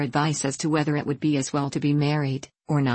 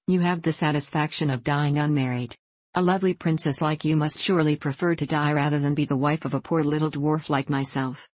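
usual speaking voice

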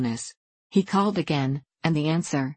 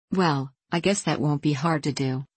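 ordinary delivery, nothing marked

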